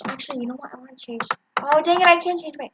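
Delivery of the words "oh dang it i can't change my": higher, loud dismay; frustrated exclamation. Real, not acted. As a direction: cry out in annoyance. Frustrated at the test.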